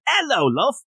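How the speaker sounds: badly faked British, pitched high; hammed-up British chimney sweep accent. Shown as a demonstration. Host demonstrates his only accent, really bad British. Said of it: "like, a really bad British accent".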